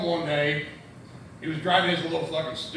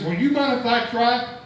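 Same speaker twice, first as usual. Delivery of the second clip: higher and louder, eager and innocent; innocent punchline ask; 7 semitones higher, 5 dB louder than usual. Retold or imitated. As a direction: ask it brightly like a naive kid. Mike's punchline question to farmer.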